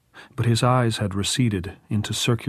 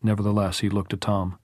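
ordinary delivery

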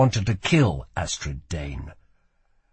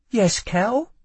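sweet; 11 semitones higher than usual. A narrator's reading. Lila answering sweetly.

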